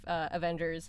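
usual speaking voice

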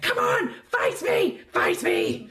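loud, high goading yell; shouted dare to fight; taunting challenge. Acted. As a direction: yell it like a riled little fighter. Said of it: "And he's like".

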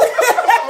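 high burst of laughter; teasing laughter. Real, not acted.

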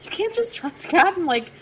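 high, incredulous imitated husband; husband's voice. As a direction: mimic a disbelieving husband. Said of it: "he's like".

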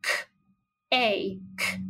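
k, a, k of cake said apart with pauses; segmented sounds. Shown as a demonstration. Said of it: "how many sounds do we hear in cake?".

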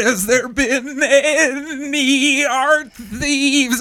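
high, slow, juddering voice; stretched-out wobbling question; shaking. Acted. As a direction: speak as if being shaken hard.

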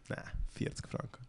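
hushed, unvoiced deadpan refusal; flat refusal. Vendor refusing to haggle over the cap, hushed deadpan. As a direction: whisper the price back, unmoved.

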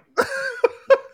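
a high, loud squeal of a laugh; high burst of laughter. Real, not acted. Laughing at his own Michael Jordan joke, high squeal of laughter.

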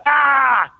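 high loud "Ah!" imitating a jump scare; mock scare yell. [name] demonstrating the jump scare he is famous for, yelling. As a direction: yell it like a movie fright. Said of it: "because I make you go".

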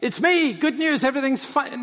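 hammed-up cheery reveal; mock cheerful. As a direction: play it light like nothing's wrong. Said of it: "immediately says to them".

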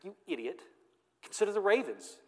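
mock-sarcastic scolding, pitch up on ravens. Shown as a demonstration. Preacher showing how Jesus didn't say it, sarcastic. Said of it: "I don't think he says this sarcastically".

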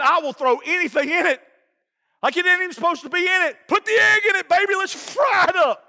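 high-pitched, hyped-up gusto, drawing out the last words; excited raving. Real, not acted. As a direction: rave about it like a giddy fan. Comic raving about his air fryer.

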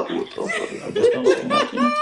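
laughing. Real, not acted. Laughing reaction.

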